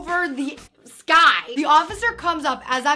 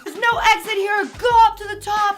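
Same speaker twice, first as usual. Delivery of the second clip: higher mock shout; officer order; 6 semitones higher, 4 dB louder than usual. Officer at bottom redirecting students, higher mock shout. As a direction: throw it up the stairs like a cop. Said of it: "that officer's saying".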